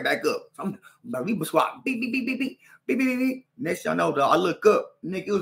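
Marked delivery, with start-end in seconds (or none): 1.8-3.5 higher beep punch noises; punch sounds. Retold or imitated. Fighting the Mexican again, punch noises. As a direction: rattle off punches.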